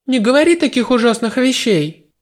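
high, shocked protest; shocked girl. A narrator's reading. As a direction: recoil at his words. Goddess shocked by hero.

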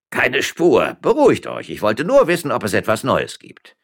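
brisk court bailiff. Acted. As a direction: answer the men with authority.

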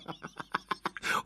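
risata soffiata e stridula; risata folle. A narrator's reading. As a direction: ride di lei con malvagità. Mad monk gloats over victim. (risatina folle del monaco)

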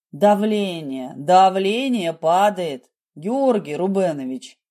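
plain reading voice

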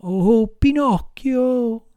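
high, slow, pitiful whine; whining voice. Acted. Fox begging Pinocchio for charity, high whining. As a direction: wheedle like a beggar fox. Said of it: "con voce di piagnisteo".

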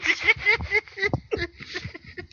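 laughing hard, very high; high-pitched helpless laugh. Real, not acted. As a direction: crack up after holding it in. Caller cracks up after the prank.

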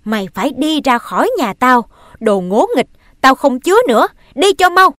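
building to loud and high; angry expulsion. Acted. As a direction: throw him out, rising to a shout. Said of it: "cố dằn lòng giận mà nói".

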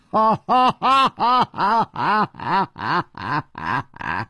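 long high falling laugh; big laugh. A narrator's reading. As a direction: throw back a long laugh. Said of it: "南宫灵也大笑".

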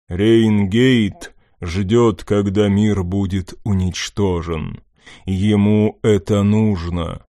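slow booming god's rumble; thunderous rumbling voice. Acted. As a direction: rumble it like a dark god. Dark god speaks.

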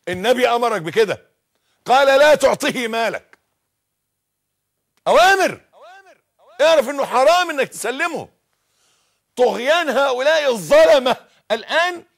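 loud, high preacher's cry; fiery preaching. Acted. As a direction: thunder the command at the crowd. Preaching at full force. (وعظ حماسي بصوت عال جدا)

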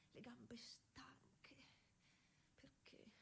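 barely a whisper, worn out; drained whisper after the long ride; tired whisper. Acted. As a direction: breathe the words out, slow and spent. Exhausted woman riding home alone.

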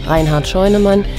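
ordinary talk

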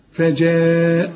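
فجاء with a very long drawn ا, slow; obligatory madd. Shown as a demonstration. Teacher to student: hold the obligatory مد in فجاء. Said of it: "لازم تمد".

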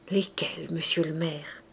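soft, low, half-whispered; subdued old man awaiting orders; hushed deferential question. A narrator's reading. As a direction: ask quietly and respectfully. Old man awaits solemn requests.

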